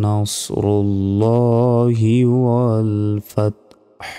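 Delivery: slow, melodic Arabic recitation with long held vowels; Quran recitation. Shown as a demonstration. Teacher opening a lesson on Surah Nasr, slow melodic recitation. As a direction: chant the verse slowly, stretching each long vowel.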